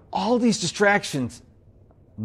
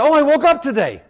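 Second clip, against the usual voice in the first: higher, upbeat and glad; bright cheerful wake-up; 7 semitones higher than usual. Shown as a demonstration. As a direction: greet the morning like a gift. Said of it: "Same words, different attitude".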